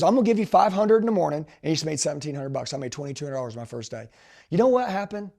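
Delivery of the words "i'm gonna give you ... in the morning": louder, higher emphatic repeat; retold excited promise. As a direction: hammer home the offer as the manager. Manager repeats the bonus offer emphatically.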